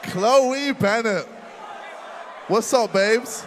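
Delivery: mocking shouted jeer; loud taunt. Real, not acted. Taunting rival about girlfriend.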